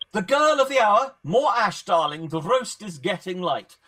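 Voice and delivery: loud, high, theatrical greeting; exuberant hostess. Acted. Varela hailing the girl of the hour, loud theatrical call.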